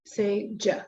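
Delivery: normal talking voice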